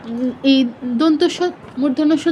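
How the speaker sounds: ordinary talk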